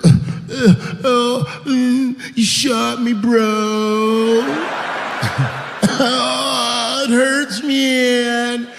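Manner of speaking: high, whimpering junkie voice; whiny dying junkie. Acted. Shot heroin addict whines to the shooter, high and whimpering. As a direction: moan like a shot addict feeling sorry.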